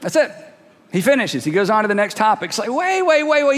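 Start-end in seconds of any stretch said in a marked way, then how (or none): 2.7-3.6 high, urgent interruption; mock protest. Startled listeners stopping James mid-letter, mock protest. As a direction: jump in high to halt someone.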